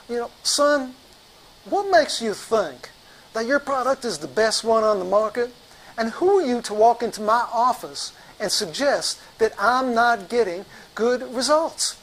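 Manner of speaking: angry imposing doctor. Retold or imitated. Big doctor staring down a sales rep, indignant. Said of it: "He's getting red in the face".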